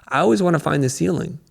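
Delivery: ordinary delivery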